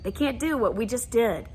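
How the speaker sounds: ordinary talk, nothing marked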